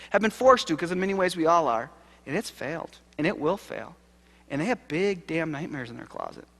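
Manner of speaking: usual speaking voice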